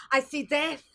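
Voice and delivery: high, loud dramatic cry; fortune teller. Acted. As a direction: shriek the omen. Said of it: "I'm a fortune teller".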